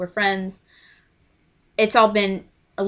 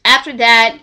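ordinary talk, nothing marked

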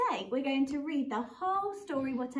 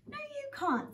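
much higher, a mother saying no; a lightly voiced Missus Bear refusing; firm mother bear; 12 semitones higher, 3 dB quieter than usual. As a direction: mum turning down her cub.

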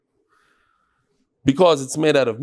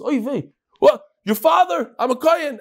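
shocked, jumping up in alarm; alarmed shout; 10 semitones higher than usual. Retold or imitated. Kohen panics hearing dead father.

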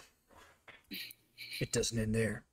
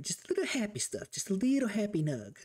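high little teasing voice; playful high-pitched downplaying; coy minimizing; 8 semitones higher than usual. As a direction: shrink it to something harmless. Playfully minimizing what he took.

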